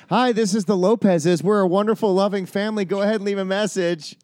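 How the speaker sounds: high, singsong outgoing message; answering machine greeting. Pretend. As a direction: play a family's voicemail greeting. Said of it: "I'll be the answering machine".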